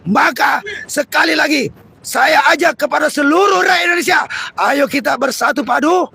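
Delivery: loud, rousing protest oration; rally the workers against the regime; fiery revolutionary speech. Real, not acted. Protest speech at full force. (pidato revolusioner berapi-api)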